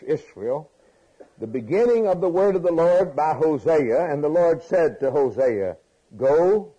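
loud, high scripture proclamation; preaching at full force. Acted. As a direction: ring the verses out to the hall.

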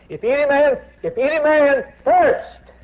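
high, loud, slowed call of the verse; preaching cry. Real, not acted. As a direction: ring it out to the whole church, stretching thirst. Preacher cries out scripture.

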